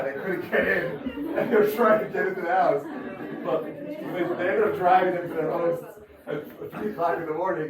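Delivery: can't hold back the laugh; laughing through punchline. Real, not acted. Punchline of funny police story.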